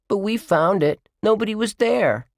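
put-on high little-boy objection; childish protest. A narrator's reading. Bradley argues over the found money, childish protest. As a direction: plead your case to big brother.

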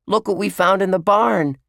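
put-on high, loud little-boy voice; excited cry. A narrator's reading. Bradley shows Josh the find, excited cry. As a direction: show off a find to big brother. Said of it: "cried Bradley".

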